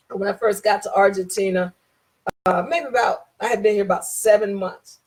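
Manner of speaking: ordinary delivery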